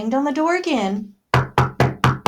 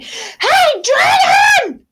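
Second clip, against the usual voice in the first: very high, loud shout; 9 dB louder, much slower than usual. Acted. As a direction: yell as loud as you can into his ear. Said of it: "she shouted as loud as she could".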